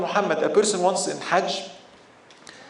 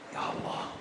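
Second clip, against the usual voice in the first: hushed whisper to God; whispered prayer; 14 dB quieter than usual. Acted. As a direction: breathe the prayer barely aloud. Man prays privately.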